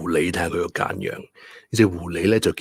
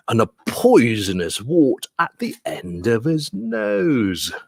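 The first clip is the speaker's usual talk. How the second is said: story reading; 4 semitones higher, 3 dB louder than usual. Acted. Teacher reading mouse describing the Gruffalo, animated storytelling. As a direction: lively rise on the mouse's boast. Reads the picture book aloud.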